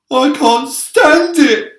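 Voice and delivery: very high, loud and slow, a squealing lament; terrified wail. A narrator's reading. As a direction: wail it in helpless terror. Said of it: "wailed the earthworm".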